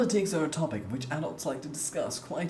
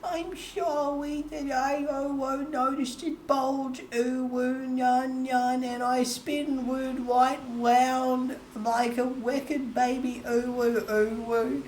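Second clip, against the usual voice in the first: cutesy nya speak; 6 semitones higher than usual. Pretend. Chat asked for uwu talk.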